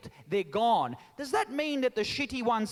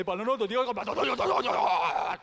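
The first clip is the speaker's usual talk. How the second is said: put-on Venetian accent; 4 dB louder, slower than usual. Mocking how Venetians speak to the Italian crowd, Venetian accent. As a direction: rattle it off in a comic Venetian accent. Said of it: "have you heard the Venetian accent".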